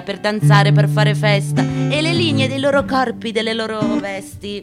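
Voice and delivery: normal talking voice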